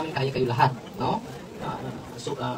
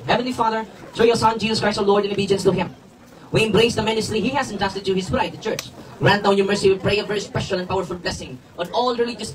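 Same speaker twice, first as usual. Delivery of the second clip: raised, driving priestly prayer; liturgical blessing prayer; 4 semitones higher, 6 dB louder than usual. Real, not acted. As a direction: proclaim the blessing loudly to all. Recites the general blessing.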